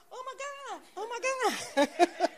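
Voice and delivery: very high, hushed gasp of awe; amazed oh my God. Retold or imitated. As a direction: breathe it out in disbelief. Speaker's own amazement retold.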